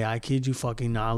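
normal talking voice

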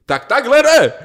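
firm shout. Retold or imitated. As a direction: put your foot down loudly. Example of acceptable argument line.

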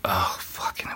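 a sudden hushed curse, breath only; retold whisper. Husband hearing wife is still downtown, whispered curse. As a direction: swear under your breath, almost no voice. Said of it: "I'm like".

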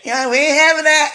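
loud, high cheering agreement; audience voice. Retold or imitated. Viewers cheering her on, loud and high. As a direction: hype up like a crowd amen.

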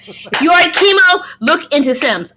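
excited. Acted. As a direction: sell it with a grin. Urging chemo patients to play Sims.

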